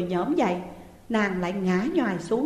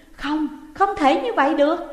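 higher, louder cry of refusal; shouting denial; 7 semitones higher, 6 dB louder than usual. Acted. Daughter shouting at mother before sobbing into pillow. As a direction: yell that it can't be. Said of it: "Dung hét to lên".

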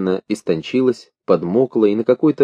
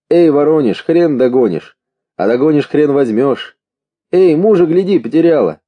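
high, louder taunting calls; raised, teasing heckle; jeering crowd shouts; 6 semitones higher, 4 dB louder than usual. A narrator's reading. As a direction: call out mockingly as if from a train window. Train crowd jeering at pursuers.

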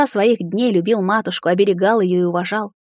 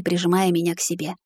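usual speaking voice

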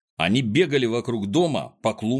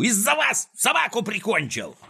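high, distraught angry cry; exasperated high-pitched accusation; Клопс yelling; 11 semitones higher than usual. A narrator's reading. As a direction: wail the blame at them in a high voice. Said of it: "заорал Клопс, хватаясь за голову".

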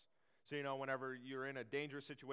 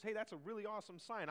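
higher-pitched excited customer quote; impressed customer; 8 semitones higher than usual. Acted. Customer admiring a shop's sign, excitedly impressed. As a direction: act out an eager, impressed customer.

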